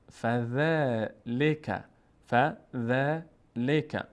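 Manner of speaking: فذلک drawn out slowly with a light, thin آ sound; thin Quranic reading. Shown as a demonstration. Teacher demonstrates the thin vowel of فذلک to students.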